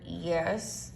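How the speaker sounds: tight, grudging answer; mildly seething admission; through clenched teeth. A narrator's reading. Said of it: "Through clenched teeth".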